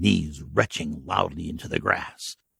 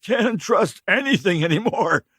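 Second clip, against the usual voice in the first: high, loud, sheepish excuse; the stranger making excuses, higher and louder; hungover drifter; 12 semitones higher, 3 dB louder than usual. Acted. Stranger blaming bad hooch, sheepish and loud. Dirty man's voice.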